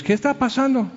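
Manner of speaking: put-on high children's voice asking; frightened children. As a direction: sound like scared kids. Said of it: "Y los niños". (voz alta de niños asustados)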